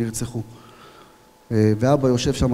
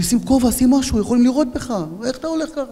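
alarmed scolding; 11 semitones higher than usual. Retold or imitated. Comrades scold him.

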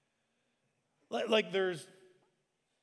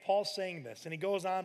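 ordinary delivery

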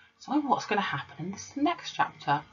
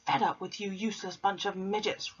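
mock roar; 4 semitones higher than usual. A narrator's reading. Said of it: "roared the Trunchbull".

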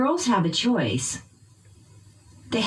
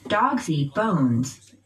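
slow "Dogs eat bones" with "Dogs" and "bones" stressed; noun stress; 2 semitones lower, slower than usual. Shown as a demonstration. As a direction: lean on both nouns, "Dogs" and "bones", falling at the end. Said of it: "you'll stress the nouns".

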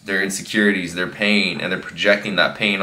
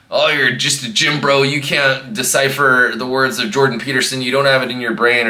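higher-pitched mock troll voice; put-on, raised voice taunting like a commenter; 5 semitones higher, 3 dB louder than usual. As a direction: imitate an indignant internet troll. Mimics an angry troll's comment.

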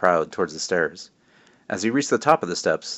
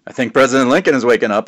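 ordinary delivery, nothing marked

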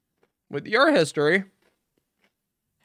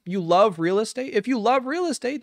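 ordinary talk, nothing marked

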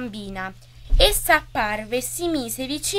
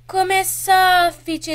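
high, slow, louder exclamation; exclaiming girl; 7 semitones higher, 7 dB louder, slower than usual. A narrator's reading. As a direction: exclaim it in drawn-out wonder. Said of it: "esclamò la bambina".

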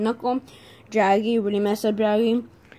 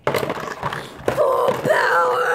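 drawn-out breathy anime battle cry, much higher; anime battle shout; 13 semitones higher, 3 dB louder, much slower than usual. Acted. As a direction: yell it like an anime hero launching. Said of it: "I'm trying to reenact".

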